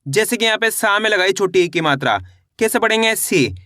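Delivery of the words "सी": सी with a briefly held, falling vowel; short i vowel. Shown as a demonstration. Teacher reading sa with short i matra, brief सी. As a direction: say सी low, without stretching the vowel. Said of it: "कम खींच के पढ़ेंगे".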